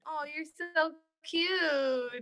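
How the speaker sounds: high, slow, drawn-out pet talk; adoring coo. Real, not acted. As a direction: melt over the cute cat.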